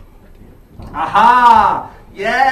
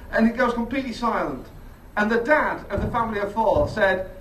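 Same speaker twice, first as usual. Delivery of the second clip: usual speaking voice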